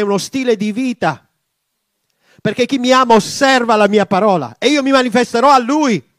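loud, high impassioned prayer; a preacher crying out to God; fervent preaching prayer. Real, not acted. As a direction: pray out with mounting fervour. Prayer rising to full force.

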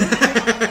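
carcajada alta y fuerte; risa fuerte. Real, not acted. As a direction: suelta la risa de golpe. Ríe recordando el susto. (carcajada fuerte)

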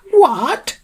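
very high, loud shrieked reply; high-pitched screech of a question. A narrator's reading. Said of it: "came back the shrieked reply".

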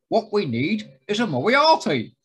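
imitated storytelling voice, lifting high and loud at the end; animated mimicry of a writer's anecdote; Terrance Dicks. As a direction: do an impression of a raconteur recalling his pitch. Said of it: "a very good Terrance Dicks".

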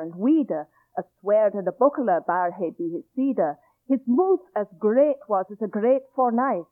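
reading Chaucer in Middle English pronunciation. Shown as a demonstration. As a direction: recite in Middle English, sounding old vowels. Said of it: "I'm going to read it in Middle English".

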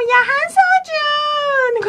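very high, drawn-out mock whine; cutesy girlish whine. Podcaster mimicking the heroine calling a boy, high cutesy whine. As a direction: stretch the name out in a squeaky pleading whine. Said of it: "hablaba como con la voz así".

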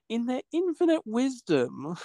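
high, drawn-out mock reverence; sarcastic. Real, not acted. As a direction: stretch it out with heavy sarcasm. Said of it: "you can tell I'm being sarcastic".

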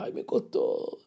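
breathy, unvoiced little lament; whispered reluctance. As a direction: whisper it like a giver feeling the cost. Reluctant giver sighs at the cost. (susurro quejumbroso de quien le cuesta dar)

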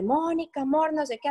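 mock call across the hospital, pitched high; high retold shout for Monica. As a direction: call out for Monica, half-acted. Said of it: "Entonces empecé a gritar".

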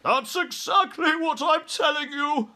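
very high, loud, frantic retort; exasperated cry. A narrator's reading. Air Force chief to army head, exasperated yell. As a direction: yell back at the top of the voice. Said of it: "cried the Air Force man".

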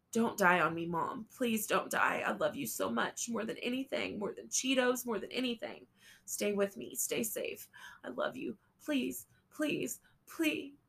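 frantic son begging his mother; desperate pleading. A narrator's reading. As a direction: beg her not to die.

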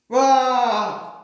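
loud, high battle cry. A narrator's reading. Meeting them on the field of battle, loud war cry. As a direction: cry out as the armies charge. Battle charge in narrated story.

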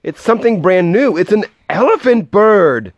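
high, thrilled amazement; crowd's astonished cry, building to the end; shouted. A narrator's reading. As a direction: announce the marvel excitedly. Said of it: "they shouted".

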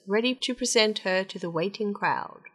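ordinary delivery, nothing marked

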